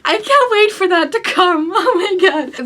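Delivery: high, gushing anticipation; overexcited. Real, not acted. Book fan awaiting her pre-ordered edition, overexcited. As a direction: gush as if nearly overwhelmed with excitement. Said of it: "I'm so excited".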